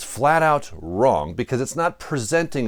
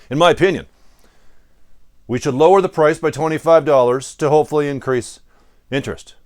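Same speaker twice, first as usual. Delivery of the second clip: louder, mock hard-nosed pitch; coming in hard; 4 semitones higher, 6 dB louder than usual. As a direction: come in firm and loud, playing the tough manager. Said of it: "I come in hard".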